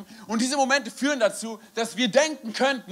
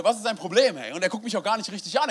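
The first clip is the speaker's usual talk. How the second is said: normal talking voice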